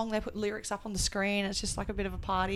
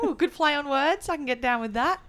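mock impressed voice; 6 semitones higher, 3 dB louder than usual. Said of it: "You're like".

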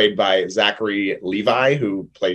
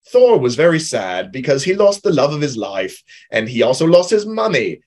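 put-on light New Zealand accent as Korg; Korg impression; 4 semitones higher, 3 dB louder than usual. Mock storyteller New Zealand accent narrating Thor's sad story. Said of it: "that was a very great impression".

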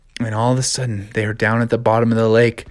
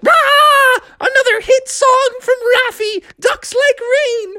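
high frog voice, sarcastic; mock a hit song; high squeaky frog voice; slower than usual.

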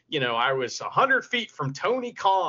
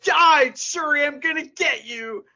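hammed-up cheesy wrestler threat, pitched higher and louder; mock wrestling promo; 10 semitones higher, 5 dB louder, slower than usual. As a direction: ham it up like a cartoon heel promising revenge. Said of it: "the whole show was like".

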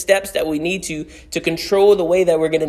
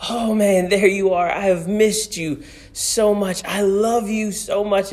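voicing the father; 6 semitones higher than usual. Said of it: "he goes".

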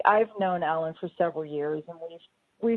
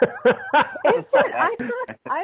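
surprised laughing; 7 dB louder, much slower than usual. Real, not acted. Surprised at accent correction.

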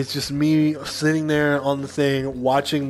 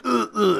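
pained grunts; 7 semitones higher than usual. Retold or imitated. Panicking, finger caught in stool.

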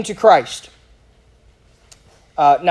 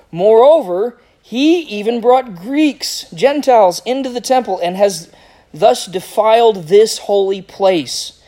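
higher-pitched, slowing accusation; emphatic scripture reading; 4 semitones higher, slower than usual. Acted. Preacher reading the crowd's charge of defiling the temple, emphatic accusation. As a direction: drive home each charge as you read. Reads the mob's accusation aloud.

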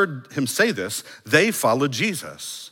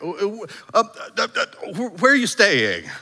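higher, stammering mock-clueless voice; dumb answer; 7 semitones higher than usual. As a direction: fumble it like a baffled follower. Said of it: "a dumb answer".

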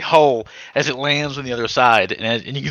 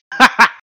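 unhinged villain cackle; maniacal laugh; 10 semitones higher, 6 dB louder than usual. Acted. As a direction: cackle like a madman watching things burn. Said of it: "let out a maniacal laugh".

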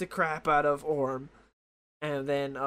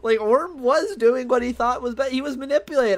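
indignant; 8 semitones higher, 7 dB louder than usual. Real, not acted. Indignant defense of Orm.

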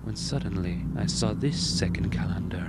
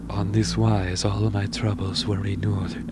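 ordinary talk

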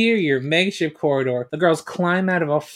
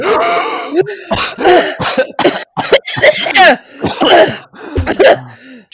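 acted choking cough; 8 dB louder than usual. Rangers choking on gas.

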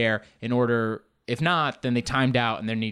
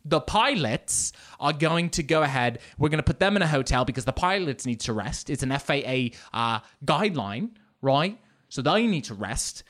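put-on insistent British airline agent, amateurish accent; hammed-up British woman sticking to her story; bad British accent. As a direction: put on a shaky-quality British accent, explaining firmly. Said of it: "there's this British woman back there. I'm very bad with accents".

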